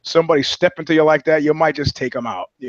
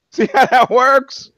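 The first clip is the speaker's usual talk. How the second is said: slow, high, laughing sarcasm; laughing mock lecture; 9 semitones higher, 5 dB louder, slower than usual. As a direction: repeat it while cracking up. Repeated mocking line, laughing.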